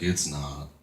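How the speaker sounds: hint of a deep, quiet caller; Tucker. Caller admits he is not the blonde, lightly voiced low old man. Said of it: "all of a sudden the voice is like".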